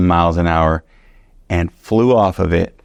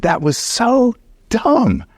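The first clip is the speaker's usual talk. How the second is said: frustrated whine; much slower than usual. Retold or imitated. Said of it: "I was mad".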